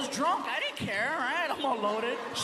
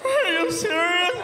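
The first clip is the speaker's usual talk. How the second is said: shocked disbelief; 8 semitones higher, 9 dB louder, slower than usual. Retold or imitated. Retold friend's shocked reaction.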